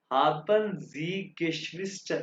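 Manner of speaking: haben Sie Geschwister said very slowly and evenly; slow model phrase. Shown as a demonstration. Teacher shows students the question, very slow and careful. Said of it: "अब देखो".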